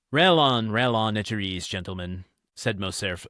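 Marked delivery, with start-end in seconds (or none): 0.1-0.7 a sharp, louder, higher burst of irritation; piqued. Acted. Morcerf bristles at his mocking friends, louder and piqued. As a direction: snap back, stung by the teasing. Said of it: "somewhat piqued".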